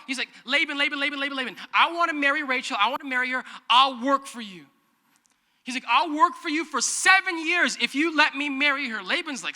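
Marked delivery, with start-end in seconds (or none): 0.5-4.6 higher, louder, eager and rushing; excited pleading. Retold or imitated. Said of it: "gets so excited". 5.9-9.0 higher, louder, eager and rushing; excited pleading. Retold or imitated. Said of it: "gets so excited".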